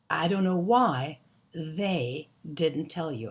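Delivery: slow, spaced-out teaching delivery; slow careful model. Shown as a demonstration. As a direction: lay it out word by word, slowly. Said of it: "we say it so fast it's hard to hear".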